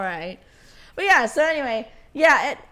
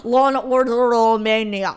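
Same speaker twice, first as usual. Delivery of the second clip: hammed-up Romanian accent; 7 dB louder than usual. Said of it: "that was awful".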